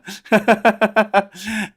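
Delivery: a burst of real laughter. Real, not acted. Laughing at a listener's joke.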